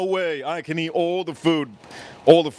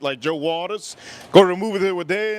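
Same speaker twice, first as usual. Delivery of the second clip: ordinary talk, nothing marked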